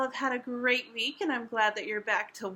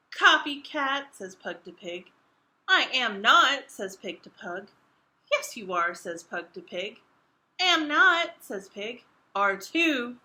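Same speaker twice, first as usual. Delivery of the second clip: louder squabbling retorts; bickering; 2 semitones lower, 4 dB louder than usual. Acted. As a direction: snap back like squabbling kids. Acted quarrel, alternating quotes.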